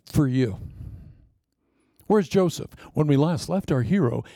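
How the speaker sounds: ordinary delivery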